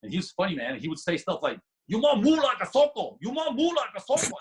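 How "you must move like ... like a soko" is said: high, loud mock Thai-accented English; imitating Thai coach's accent. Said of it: "This is really how he talked".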